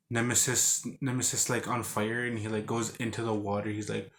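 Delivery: ordinary talk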